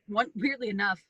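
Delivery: ordinary delivery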